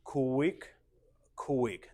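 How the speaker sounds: SLOW stretched long and rising, each QUICK set apart; dance step count. Shown as a demonstration. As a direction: count the steps in rhythm. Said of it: "we say slow".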